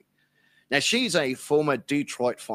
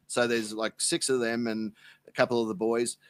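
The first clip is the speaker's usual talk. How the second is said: ordinary delivery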